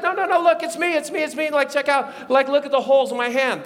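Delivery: high, hurried convincing; insistent reassurance. Acted. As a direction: start loud and high, talk them out of panic. Jesus reassures the panicking disciples.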